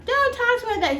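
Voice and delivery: very high mimicked woman's voice; imitated repeated protest. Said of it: "she just kept saying that over and over".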